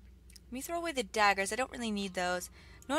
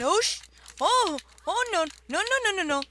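high, loud, repeated cries of panic; panicked cries; 9 semitones higher, 8 dB louder than usual. Real, not acted. Gamer watching her character die, real panicked cries. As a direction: cry out as disaster hits.

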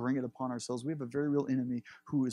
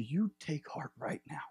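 whispered comfort; 2 semitones higher than usual. Acted. Jesus whispers encouragement to the man he has healed. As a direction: lean in and whisper it to reassure him.

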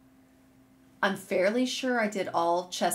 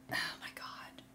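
breathless, whispered oh my God; awed whisper; 15 dB quieter than usual. Real, not acted. Knitter stunned by the yarn, whispered awe.

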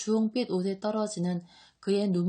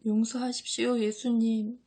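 higher, apologetic murmur; tearful murmur; 3 semitones higher than usual. A narrator's reading. John begs Jesus's forgiveness, tearful murmur. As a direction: murmur an apology through acted tears. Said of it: "하고 요한이 중얼거린다".

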